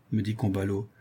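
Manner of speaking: ordinary delivery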